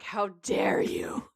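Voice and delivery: slow, drawn-out mock outrage; mock indignation. Retelling Sam and Dean's reaction to the announcement, slow mock indignation. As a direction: stretch it out, playfully affronted. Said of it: "Sam and Dean look really pissed off".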